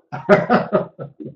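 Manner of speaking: breathy amused chuckle; short real chuckle at the joke; real laugh. Real, not acted. Joke about a mixed dog.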